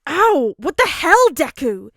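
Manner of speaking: very loud high outraged yelp; spluttering yell. A narrator's reading. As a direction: yell out in shock. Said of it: "he splutters".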